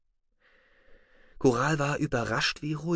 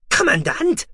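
much higher, louder outraged call; indignant cry; 5 dB louder than usual. Acted. Deputy crying out at his commander, outraged and high. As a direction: protest as if insulted. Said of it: "rief Zollmon empört".

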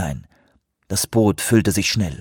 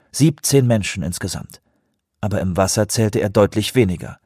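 ordinary delivery

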